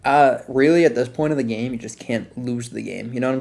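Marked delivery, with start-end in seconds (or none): none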